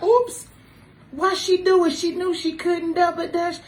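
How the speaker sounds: mocking onlookers. Friends mocking her after she falls jumping rope. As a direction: play the friends snickering at her fall. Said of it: "y'all be like".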